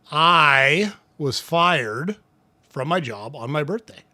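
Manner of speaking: long drawn-out "I", then a pause after "fired"; stress on I. Shown as a demonstration. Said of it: "All of them have a little different connotation".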